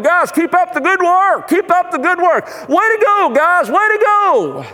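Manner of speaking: high, drawn-out cheering call; imitating a cheerleader's high-pitched rallying yell; cheerleader with megaphone. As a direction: cheer the team on as if through a megaphone. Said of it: "He was the cheerleader. He had the megaphone".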